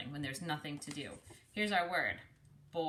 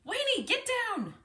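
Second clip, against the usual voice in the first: calling out; 4 dB louder, slower than usual. Acted. Calling to boy on windmill.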